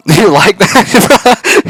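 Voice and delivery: very high, loud, slow drawn-out laughing taunt; booming, giggling, slowed-down tease; laughing mock tease. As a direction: stretch it out, cackling, hamming it up. Teasing robot while feeding her.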